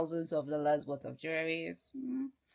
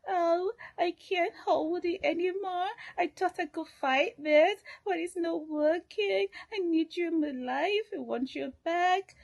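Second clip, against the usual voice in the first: soft pleading; 11 semitones higher than usual. Retold or imitated. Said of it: "all of that in that softness".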